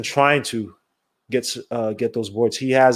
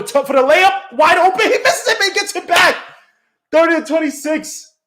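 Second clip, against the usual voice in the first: high, loud rising call; excited play-by-play; 13 semitones higher, 4 dB louder than usual. Real, not acted. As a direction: build the call up as he drives. Live layup call.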